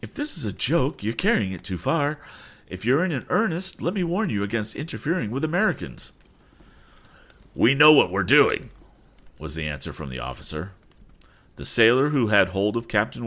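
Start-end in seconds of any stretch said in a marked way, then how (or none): 0.0-6.1 high-pitched, protesting captain; indignant warning. Acted. Captain warning officer against interfering with Americans, indignant. 7.5-8.7 louder, higher officer's comeback; forceful retort. Acted. Officer brushing off the captain's warning, forceful retort.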